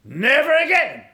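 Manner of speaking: high, loud, declaring preacher; forceful preaching. Acted. Preacher quotes Caleb ready for war, forceful preaching. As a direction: proclaim it like a battle vow.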